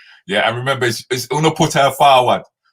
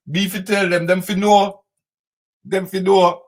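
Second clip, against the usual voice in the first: high Jamaican patois lilt; patois phrase; 9 semitones higher, 3 dB louder than usual. Pretend. Jamaican patois call to the listeners. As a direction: throw it out in broad Jamaican patois.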